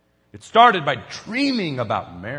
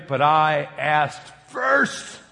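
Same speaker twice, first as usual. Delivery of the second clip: very slow, drawn-out mock protest; aggrieved complaint; 3 semitones lower, much slower than usual. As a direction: stretch it out like someone passed over. Acting out an overlooked believer's complaint.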